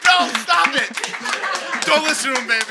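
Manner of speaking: high-pitched, protesting call; protesting outburst. Acted. Debater objects as the host asks the audience, protesting outburst. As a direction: cut in to object. Debater calls out over the verdict.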